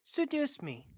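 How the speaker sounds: soft seductive request. Acted. Asking to be seduced, very soft and high. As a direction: say it small and hushed. Same line, first soft then shouted.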